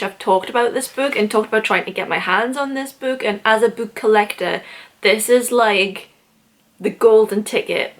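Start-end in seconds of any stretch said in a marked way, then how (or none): none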